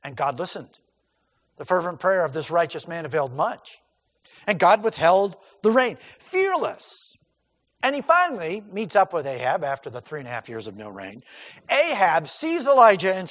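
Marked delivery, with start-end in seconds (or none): none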